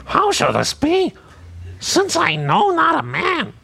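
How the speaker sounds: high, drawn-out Marge Simpson impression. Shown as a demonstration. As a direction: do your best Marge Simpson. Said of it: "the only lady's voice I do is Marge Simpson".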